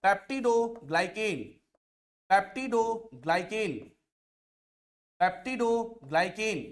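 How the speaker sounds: very slow, repeated term; slow drilling. Shown as a demonstration. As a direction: say peptidoglycan slowly three times for dictation. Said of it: "teacher repeats the term for students".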